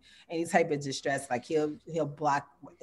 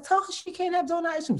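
high mock nagging; 13 semitones higher than usual. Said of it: "I'm like".

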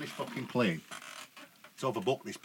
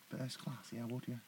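quiet inner voice; 14 dB quieter than usual. Retold or imitated. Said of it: "I'm thinking".

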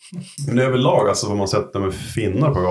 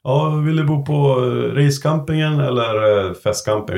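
gate staff; 6 semitones higher than usual. Retold or imitated. Camping staff asking arrivals where to stay, put-on voice. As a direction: put on a high voice for the camping question. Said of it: "De bara".

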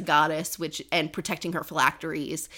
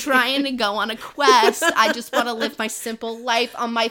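higher-pitched whiny refusal, acted; playfully exasperated, insistent protest; mock protest; 4 semitones higher, 5 dB louder than usual. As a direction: plead like a reluctant hero who wants no part. Acting out reluctant hero's protest.

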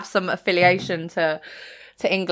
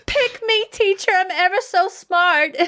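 high, eager schoolboy impression; Martin; 12 semitones higher than usual. Co-host quotes an eager pupil to teacher, high impression. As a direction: put on a very high, pleading pupil voice. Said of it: "obviously Martin is hilarious".

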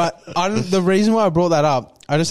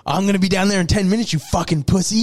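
higher, fast tough-guy threat; mock angry threat; 5 semitones higher, faster than usual. Passenger on his last phone call, mock threatening. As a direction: act out picking a fight.

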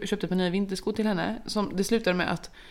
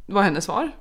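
ordinary delivery

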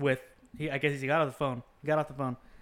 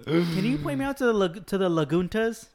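higher, louder mock customer asking for Laguntas; 6 semitones higher, 5 dB louder than usual. Mocking customers to a friend, a higher, louder clueless voice. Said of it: "customers say that".

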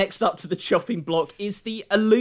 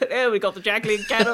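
plummy upper-class voice; posh voice; 3 semitones higher, faster than usual. Pretend. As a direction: say it like a posh announcer. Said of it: "the posh voice is out already".

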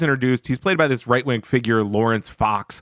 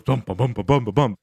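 imitated heartbeat; 2 semitones lower than usual. Host acting out Hunter's heart on cocaine, imitated heartbeat. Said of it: "then his heart goes".

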